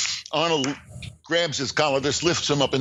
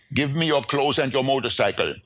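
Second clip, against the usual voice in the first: ordinary talk, nothing marked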